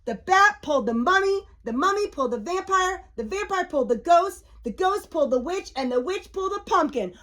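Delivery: brisk cumulative storybook rhythm; quick chain. A narrator's reading. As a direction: rattle off each link with lilting pitch. Chain of pullers described.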